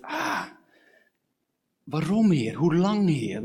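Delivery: exasperated prayer outburst; anguished lament. Acted. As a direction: plead upward in frustration, opening on a high "ah". Acts out lament to God.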